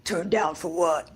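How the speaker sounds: low put-on voice; character voice try-out. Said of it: "That was good".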